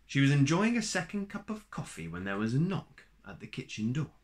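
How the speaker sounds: ordinary delivery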